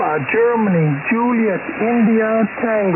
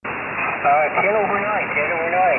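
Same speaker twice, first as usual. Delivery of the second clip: over a radio. Real, not acted. Check-in heard over ham radio.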